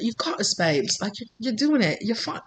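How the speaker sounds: soft attempt at a British accent; lightly faked British spirit guide; murmured encouragement in a bad British accent. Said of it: "that's what I keep hearing".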